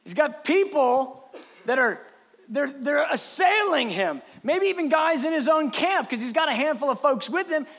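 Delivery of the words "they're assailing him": usual speaking voice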